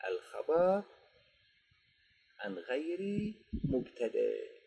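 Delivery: ordinary talk, nothing marked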